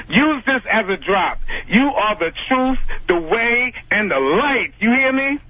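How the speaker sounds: usual speaking voice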